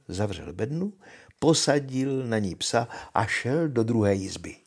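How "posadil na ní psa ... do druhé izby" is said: plain reading voice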